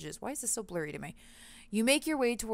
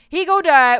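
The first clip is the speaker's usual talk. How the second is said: "He gonna die" shouted far higher and much louder; panicked yell; 8 semitones higher, 17 dB louder than usual. Real, not acted. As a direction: blurt it out in sudden alarm.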